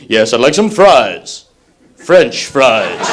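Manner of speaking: low, very slow mock suave order; suave Bond at Burger King. Guy ordering fries in Bond style, slow and low. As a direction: drag out the fries like 007. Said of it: "guys going into Burger King going".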